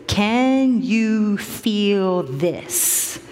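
very drawn-out testing question; slow, deliberate. Retold or imitated. Doctor probing Mister Hernandez's wound with a stick, slow and deliberate. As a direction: stretch every word out, deliberately.

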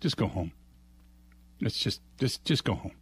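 lightly voiced, sending him away; a hint of a fed-up store manager; weary manager. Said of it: "manager just says".

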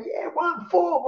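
drawn-out high cheer, slow; stretched fan chant; crowd cheer. Retold or imitated. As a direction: call it out slowly like fans in a packed room. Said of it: "everyone's screaming out".

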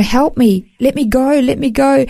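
retold cry for help, voice climbing; desperate pleading. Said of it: "and I'm saying".

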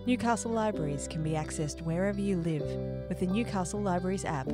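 usual speaking voice

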